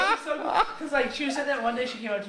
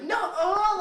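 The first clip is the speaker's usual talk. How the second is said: high, loud mock shriek of refusal; loud, high retold squeal; freaked-out girls; 12 semitones higher, 5 dB louder than usual. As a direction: imitate girls freaking out, pitched way up. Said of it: "like, freaked out".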